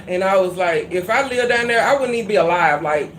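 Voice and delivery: usual speaking voice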